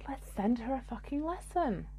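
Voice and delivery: hushed, mischievous voice of the universe; the universe quietly plotting her a lesson. Retold or imitated. Universe voicing its plan to teach her, hushed and mischievous. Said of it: "And the universe was like".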